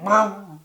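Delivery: loud, rising-pitch motor noise; engine roar. Retold or imitated. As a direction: imitate an engine taking off.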